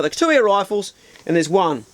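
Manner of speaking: usual speaking voice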